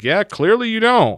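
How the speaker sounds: mock exasperation, very high and loud; exasperated comeback. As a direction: imitate a mother's loud, fed-up comeback. Mother snaps back in retold argument.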